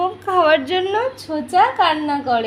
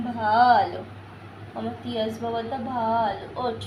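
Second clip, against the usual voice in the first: tender coaxing; 5 semitones lower, 4 dB quieter than usual. Real, not acted. Parent coaxing the sulking toddler off the floor, tender and soft. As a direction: coax him gently, much softer.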